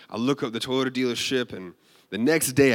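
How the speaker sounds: normal talking voice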